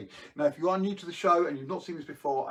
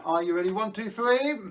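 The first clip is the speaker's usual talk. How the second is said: put-on kids party magician; 7 semitones higher, 6 dB louder than usual. Magician leads kids' countdown.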